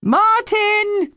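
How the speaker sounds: high, loud yell for her son; mother yelling for her missing son. A narrator's reading. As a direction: call him sharply across the beach. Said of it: "yelled his mother".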